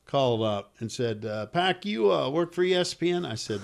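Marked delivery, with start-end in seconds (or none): 1.5-3.2 put-on Texas accent. Caller retold with Texas accent.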